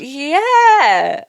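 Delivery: loud, high, drawn-out sarcastic yeah. Real, not acted. Answering co-host who asks if it was shit, sarcastic. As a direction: stretch it out with mock agreement. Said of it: "But don't be sarcastic".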